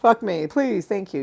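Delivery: ordinary delivery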